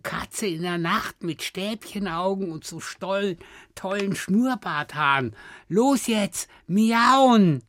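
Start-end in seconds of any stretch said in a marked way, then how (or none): 5.7-7.6 loud urging. Real, not acted. Urging a cat meow game.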